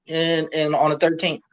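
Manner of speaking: ordinary talk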